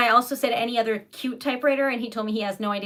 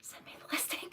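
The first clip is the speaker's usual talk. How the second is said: breathless, awed whisper; whispering her envy to a viewer; excited whisper; 20 dB quieter than usual. Real, not acted. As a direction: whisper it like you can't believe it. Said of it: "I'm freaking out".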